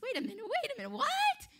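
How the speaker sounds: high-pitched, hammed-up disbelief; comically overacted astonishment; mock surprise. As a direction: build to a loud, squeaked shock. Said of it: "he's acting all surprised".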